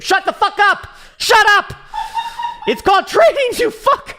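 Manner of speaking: furious laughing shout at a chat question; angry shouting. Real, not acted. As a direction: blow up at the viewer, half laughing. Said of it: "I'm getting pissed off today".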